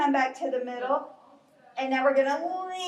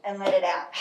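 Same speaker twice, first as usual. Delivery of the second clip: calm breathing guide; 6 semitones lower than usual. Shown as a demonstration. Leading a slow bear breathing exercise.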